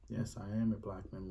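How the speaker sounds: ordinary talk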